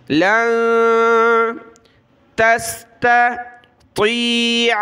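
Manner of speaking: slow drawn-out tajweed chant; slow melodic Quran recitation. Shown as a demonstration. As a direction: stretch each sound like a reciter. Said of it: "इनको पतला पढ़ना है".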